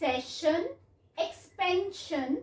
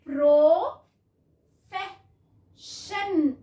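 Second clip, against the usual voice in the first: Profession very slow and louder, each sound stretched; slow blended word; 6 dB louder, much slower than usual. Shown as a demonstration. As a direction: blend profession out slowly for the class. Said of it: "उसके बाद".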